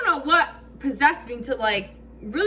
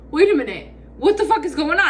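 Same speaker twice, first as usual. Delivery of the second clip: louder, urgent wait-a-minute; alarmed realization; 5 semitones higher, 5 dB louder, faster than usual. Retold or imitated. Teller realizing her friend ditched her with the cop, alarmed and louder. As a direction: snap to attention in alarm.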